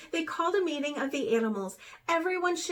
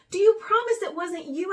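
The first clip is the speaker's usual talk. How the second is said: higher, louder earnest plea; pleading; 6 semitones higher, 6 dB louder, faster than usual. A narrator's reading. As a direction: beg her to say it. Pleading with Raven.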